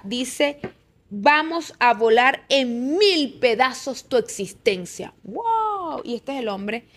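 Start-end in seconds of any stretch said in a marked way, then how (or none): 1.1-5.1 slow, weighty reading of the threat, hammed up a little; mock-solemn menacing quote; slow mocking Trump quote. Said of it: "se las voy a volver a leer textualmente". 5.2-6.0 high, sarcastic wow; mock amazement. Real, not acted. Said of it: "reacting to the quote".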